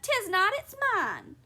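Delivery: high, loud squabbling retort; childish contradiction. A narrator's reading. Husband contradicting wife over towel, high childish retort. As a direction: snap back in a high, petulant voice.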